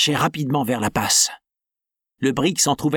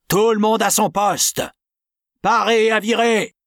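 loud, high, imperious order; mysterious captain ordering the crew to stations; commanding voice; 7 semitones higher, 3 dB louder than usual. Acted. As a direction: take command of the deck. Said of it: "une voix forte, énergique, impérieuse".

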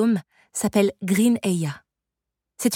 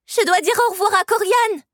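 put-on high, urgent little-girl cry; child's exclamation; 13 semitones higher, 4 dB louder than usual. A narrator's reading. Girl pulled away from her friend, crying out urgently. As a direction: call out suddenly, pitched far up. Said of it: "s'écria la fillette".

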